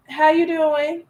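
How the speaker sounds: high, wary echo with a rising edge; suspicious reply. Retold or imitated. Woman answering a stranger's hello with suspicion. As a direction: throw the greeting back with distrust. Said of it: "And you're like".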